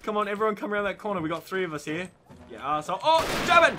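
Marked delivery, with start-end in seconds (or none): 3.0-3.8 high, loud startled yell; alarmed shout. Real, not acted. Gamer spotting a German, high alarmed shout. Spots an enemy.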